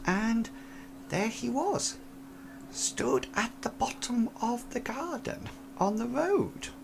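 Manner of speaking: put-on soft and spooked, like a frail old woman; old lady voice. Old lady recounts seeing figure.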